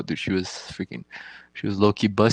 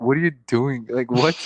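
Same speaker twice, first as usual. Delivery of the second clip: higher, louder startled protest; startled what; 5 semitones higher, 6 dB louder than usual. Retold or imitated. Patient protesting the doctor stroking his thigh, startled. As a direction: blurt it out, alarmed and confused.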